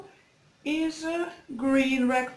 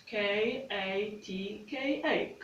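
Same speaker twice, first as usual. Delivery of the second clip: slow spelling; 5 dB quieter, slower than usual. Shown as a demonstration. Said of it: "spelling the name slowly".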